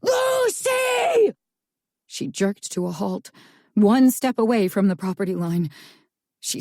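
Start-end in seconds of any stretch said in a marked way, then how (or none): 0.0-1.3 desperate booming call of her name; booming shout. A narrator's reading. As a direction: boom her name across the woods.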